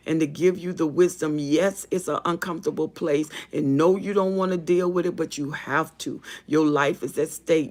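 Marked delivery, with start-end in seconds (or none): none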